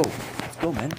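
whispered cheer. Real, not acted. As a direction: hiss it under your breath, still excited. Repeated cheer, takes differ.

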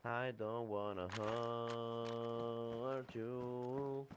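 very slow, low mock menace; slow deep drawl. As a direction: stretch every word out low. Mocking teammate's line.

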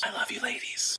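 a sheepish unvoiced whisper; whispered apology to the ladies; whispered aside. Real, not acted. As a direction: whisper it quickly as a peace offering. Whispered aside after sexist joke.

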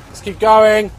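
rallying call. Real, not acted. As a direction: call it out to spur yourself on.

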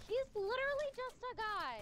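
high-pitched sassy mimicry; sassy imitation. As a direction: mimic a sassy squeal, high but not shouted. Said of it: "She sounds real sassy".

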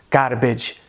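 "garbage" with a tapped Indian R; Indian English accent. Shown as a demonstration. Teacher showing the R, Indian accent. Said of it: "Mais uma vez".

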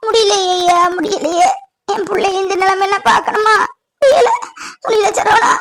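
mother wailing, unable to bear it; keen brokenly through sobs; anguished crying. Acted. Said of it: "அழாதீங்கம்மா". (தாங்க முடியாமல் அழும் குரல்)

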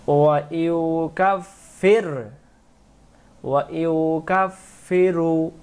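slow melodic tajweed chanting; slow chanted Quranic recitation. Shown as a demonstration. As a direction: draw the vowels out in a sung recitation. Recites verse demo.

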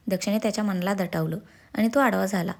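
plain reading voice

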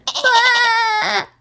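high, crying goat-like bleat. Acted. Unicorn bleating from the box, high distressed bleat. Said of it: "Sparkle bleated".

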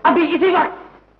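angry demand. Acted. Brother demanding five hundred rupees, angry demand. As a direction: demand it instantly, loud and high.

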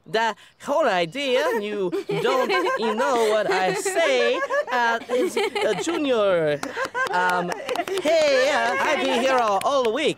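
loud, high mocking impression of a classmate; Junior. Boy entertaining friends by mocking a classmate, exaggerated impression. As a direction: mimic the odd kid, drawing words out high and loud. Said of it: "do more Junior".

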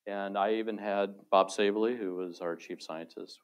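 ordinary talk

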